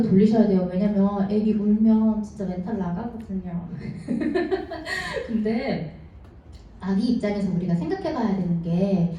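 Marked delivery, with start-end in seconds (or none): 3.7-5.2 short high giggle; a quick real laugh; soft giggle at the joke; light laugh. Real, not acted.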